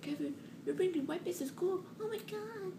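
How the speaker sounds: very high scandalized teacher voice; shocked teacher. Retold or imitated. Teacher catching Kevin with the joint, very high scandalized.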